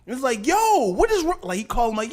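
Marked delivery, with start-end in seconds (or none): 0.4-1.4 high-pitched mock outrage; exasperated father. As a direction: play a dad demanding an explanation. Acting exasperated father on phone.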